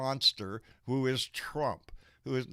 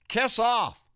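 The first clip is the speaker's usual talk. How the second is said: "Kiss off." shouted much higher and louder; angry shout; 10 semitones higher, 7 dB louder than usual. Real, not acted. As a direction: bark "Kiss off." at the traitors. Angry rant at secessionists.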